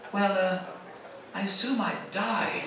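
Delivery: small, hesitant English voice, softly; sheepish. Retold or imitated. English audience member answers after a tense wait, sheepish. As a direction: imitate a sheepish Englishman, quiet and drawn out. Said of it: "in this little sheepish voice".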